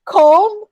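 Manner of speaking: comb said as "kohm"; correct pronunciation demo. Shown as a demonstration. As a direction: say comb with a long o, silent b. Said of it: "cum, not cum".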